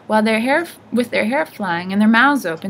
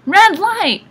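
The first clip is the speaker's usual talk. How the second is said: very high, loud screamed warning; urgent shrieked alarm; 12 semitones higher, 9 dB louder than usual. A narrator's reading. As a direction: scream it like a panicked old woman.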